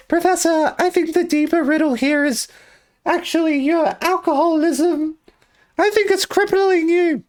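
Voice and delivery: put-on squeaky high young boy. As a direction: pitch way up like a kid. Acting Luke in skit.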